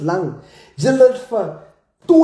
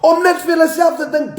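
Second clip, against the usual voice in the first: higher, fast angry shout; 8 semitones higher, faster than usual. Real, not acted. Scolding spinners for selfishness, angry shout. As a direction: fire it at them.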